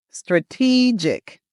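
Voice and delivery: "strategic" slowly with a long stressed TEE. Shown as a demonstration. Coach showing how you should say it, long E. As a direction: stretch "strategic" as struh-TEE-jik. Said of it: "make that long E sound".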